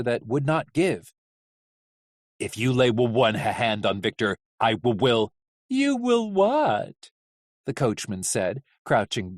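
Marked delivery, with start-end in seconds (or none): 2.4-5.3 low, stuttering menace; low growing threat, catching on words; stammering threat. Acted. Hap stammers a threat at captor. 5.7-7.1 high, drawn-out mocking question; slow theatrical taunt; taunting coachman.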